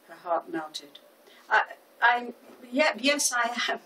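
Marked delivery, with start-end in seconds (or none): 1.5-3.8 flustered, high-pitched eager stammer; overenthusiastic. A narrator's reading. Smitten florist answers the handsome stranger, far too enthusiastic. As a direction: gush out the yes far too keenly. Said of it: "replied with far too much enthusiasm".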